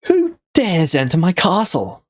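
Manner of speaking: booming villain voice; high, imperious castle-lord challenge. Acted. Villain voiced by game master.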